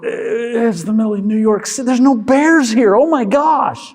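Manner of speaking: high, exasperated mock outburst; exasperated disbelief. Prefrontal cortex exasperated.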